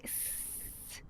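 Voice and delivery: soft unvoiced cobra hiss; snake hiss. Shown as a demonstration. Yoga teacher showing kids the cobra, soft snake hiss. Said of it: "turn to one side and say".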